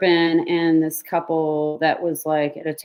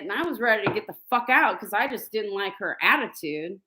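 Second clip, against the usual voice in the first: usual speaking voice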